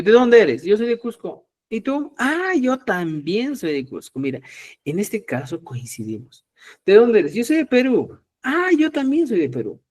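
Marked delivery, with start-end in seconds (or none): 0.0-4.2 higher-pitched role-play of a friendly exchange; animated mock conversation in a raised pitch; acted sample dialogue. Acts out sample where-from dialogue. 6.9-9.8 high-pitched mock surprise at a coincidence; animated little role-play, voice lifted high; acted sample dialogue. Acts out coincidence of origin.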